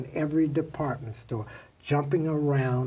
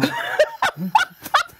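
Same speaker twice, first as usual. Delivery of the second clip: laugh; 6 dB louder than usual. Real, not acted. Laughing at his own trick.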